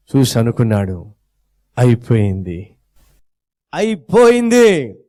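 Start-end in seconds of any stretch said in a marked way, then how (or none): 1.8-2.8 low, murmured అయిపోయింది as a private thought; low gloat. Acted. Satan thinking at the cross, low murmured gloat. First take of Satan's line. 3.7-5.0 అయిపోయింది high, louder and slow, stretched out; exultant gloat. Acted. Satan crowing over the cross, slow high triumph.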